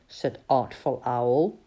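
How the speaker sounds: ordinary delivery, nothing marked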